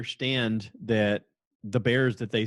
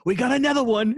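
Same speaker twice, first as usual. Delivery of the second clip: much higher, louder mock Australian shout; hammed-up Australian accent, yelling over a new find; husband imagined as an Australian TV snake catcher, shouting; Australian wildlife-host shout; 13 semitones higher, 6 dB louder than usual. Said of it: "have Dan suddenly have an Australian accent".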